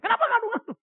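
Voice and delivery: loud, high-pitched mock telling-off; retold scolding. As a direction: imitate yourself angrily confronting mum. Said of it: "saya marahin lagi mama saya".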